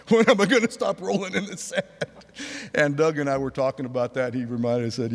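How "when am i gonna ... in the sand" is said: laughing recollection. Retold or imitated. Laughing recalling the crash.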